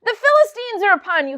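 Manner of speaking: high, loud alarm call; urgent shout. Acted. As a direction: yell to wake him up fast. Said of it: "she's like".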